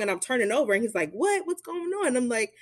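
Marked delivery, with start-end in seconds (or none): 1.1-2.2 high, sudden "what?" of alarm; jolted into asking what's happening; startled partner. Retold or imitated.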